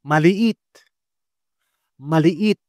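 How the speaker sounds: "Maliit" with two separate i sounds; model word. Shown as a demonstration. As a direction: split the ii in "Maliit". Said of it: "Next word". (hiwalay na i-i sa "Maliit")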